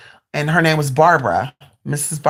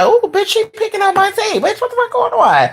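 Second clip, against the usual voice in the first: very high, squealed inner reaction; exclaiming in shock. Retold or imitated. Storyteller thinks she is being read, shocked. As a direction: squeal it like you've been found out. Acts out alarmed thoughts at reading.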